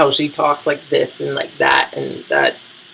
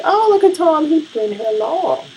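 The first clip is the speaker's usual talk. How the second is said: mock surprised; 14 semitones higher than usual. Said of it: "I'm like".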